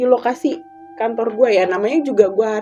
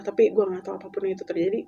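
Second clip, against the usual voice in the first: normal talking voice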